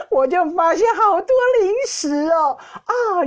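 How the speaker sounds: high-pitched, giggly reveal; barely holding back laughter; gleeful laughing. Real, not acted. Telling listeners about the hidden snacks found, gleeful laughing.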